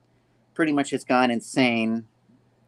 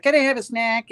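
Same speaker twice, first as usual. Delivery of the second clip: put-on much higher whiny child voice; a little kid pleading for snacks; kid begging; 11 semitones higher than usual. Children beg parents for a snack in a high child voice.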